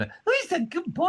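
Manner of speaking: very high gushing dog voice; sugary high puppy talk; mock baby talk to a dog. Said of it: "Talk to your dog in a normal voice".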